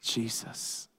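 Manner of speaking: breathy hushed whisper of the name; soft reverent whisper. Acted. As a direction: whisper it like a secret.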